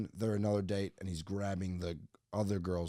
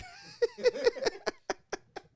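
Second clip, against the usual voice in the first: high, squealing burst of laughter; real laugh cracking way up high; laughing at his own punchline; big laugh. Real, not acted.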